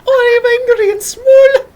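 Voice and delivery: very high, loud, drawn-out squeal; hammed-up little furious creature; tiny angry voice. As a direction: squeak with tiny exaggerated rage. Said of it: "tiny accent".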